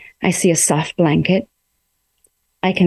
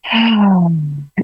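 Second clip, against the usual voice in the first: long audible exhale; a real breath out to calm down; deep sigh. Real, not acted. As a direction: let out a slow deep sigh. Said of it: "Oh, taking a deep breath".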